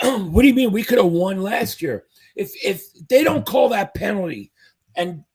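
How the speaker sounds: normal talking voice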